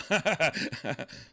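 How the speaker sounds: loud burst of laughter. Real, not acted. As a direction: break into laughing. Laugh after sad story.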